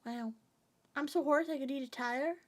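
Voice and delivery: normal talking voice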